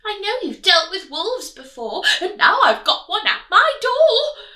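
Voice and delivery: high, loud anxious piglet; worried piglet. Acted. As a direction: explain in a hurry. Pig explaining.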